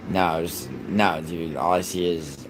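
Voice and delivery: ordinary talk, nothing marked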